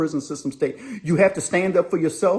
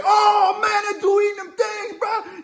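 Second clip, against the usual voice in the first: mock yell. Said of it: "Everybody's like".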